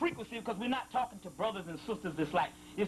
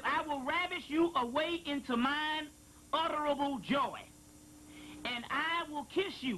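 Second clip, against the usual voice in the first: scripture reading; 4 semitones higher than usual. Acted. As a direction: draw out each word like prophecy. Reading a sacred passage aloud.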